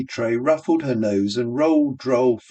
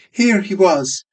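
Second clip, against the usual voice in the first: barmaid voice; 8 semitones higher, 5 dB louder than usual. Acted. Barmaid mimics in voice.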